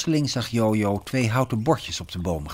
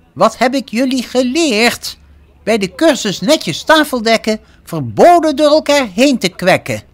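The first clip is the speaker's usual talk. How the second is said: high, loud scolding; stern teacher shouting in a high voice; strict cookery teacher; 13 semitones higher, 10 dB louder than usual. A narrator's reading. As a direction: scold a noisy class at full volume. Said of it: "Ze was wel streng".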